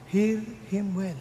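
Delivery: slow, hushed Hear him well; slow, soft emphasis. Shown as a demonstration. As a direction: stretch every word of Hear him well, softly.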